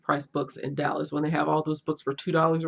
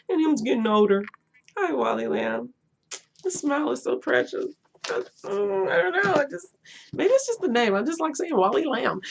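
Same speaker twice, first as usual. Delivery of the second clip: gushing; 10 semitones higher than usual. Real, not acted. Gushing over author photo.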